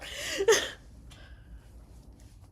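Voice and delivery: breathy high madcap laugh; high unhinged cackle. Acted. Gunner gloating at the soldiers, high wild cackle. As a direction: cackle as if nothing matters.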